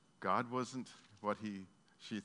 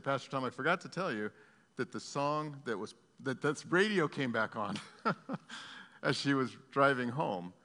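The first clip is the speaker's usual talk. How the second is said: higher and louder, tumbling out fast with a laugh; excited retelling; 5 semitones higher, 8 dB louder than usual. Retold or imitated. Pastor retelling the woman's news to the congregation, higher and louder with joy. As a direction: burst with her excitement as you tell it.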